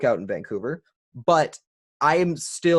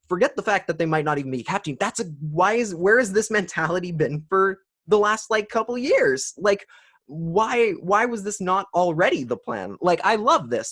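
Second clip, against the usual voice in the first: higher-pitched, incredulous retelling of a thought; exasperated reaction; 7 semitones higher, 3 dB louder than usual. Retold or imitated. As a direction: plead the obvious, pitch riding up. Voices own exasperated reaction to rumour.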